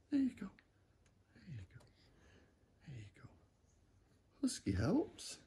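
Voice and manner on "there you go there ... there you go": fading soothing hush. Real, not acted. Settling the baby down.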